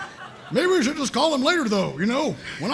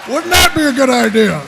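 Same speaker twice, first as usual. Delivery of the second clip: much louder, pushing the idea hard; loud insistence; 10 dB louder than usual. Acted. Sarge pressing Vic to call Blue Base, loud. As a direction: shout it to win the argument.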